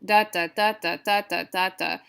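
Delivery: "Da, da" in even strong-weak pairs; rhythmic "Da, da" syllables, first of each pair stressed; trochaic beat. Shown as a demonstration. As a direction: chant the meter on "Da, da". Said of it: "sorry, that's trochaic".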